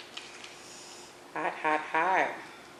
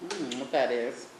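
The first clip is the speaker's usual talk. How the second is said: ordinary talk, nothing marked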